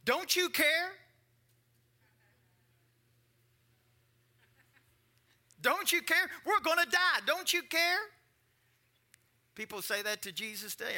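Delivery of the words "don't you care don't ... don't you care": high, loud desperate cry; panicked pleading. Retold or imitated. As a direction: beg in mock alarm. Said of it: "what religious people say today".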